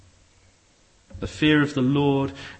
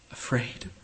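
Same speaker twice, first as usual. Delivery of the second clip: soft whispered echo; murmur; 2 semitones lower, 8 dB quieter than usual. A narrator's reading. Rat murmuring his answer to Mole. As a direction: breathe the word back in awe. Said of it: "murmured the rat".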